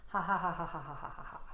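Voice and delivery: light exhaled laughter, fading off; gentle giggle. Shown as a demonstration. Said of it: "breathe out with a gentle giggle".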